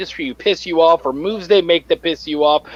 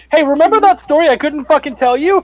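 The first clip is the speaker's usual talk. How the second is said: higher, lively teasing quote; playful quote; 6 semitones higher than usual. Retold or imitated. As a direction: lean in like sharing gossip. Quoting green room chat.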